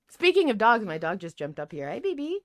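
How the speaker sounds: normal talking voice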